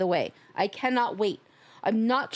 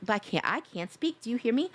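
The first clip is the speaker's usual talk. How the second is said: ordinary talk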